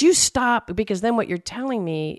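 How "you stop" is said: higher, louder mock snap. Imitating snapping at a partner who nags.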